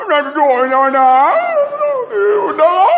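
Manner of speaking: high pained whimper; child protesting he cannot sit, pained and high; whining complaint. Retold or imitated.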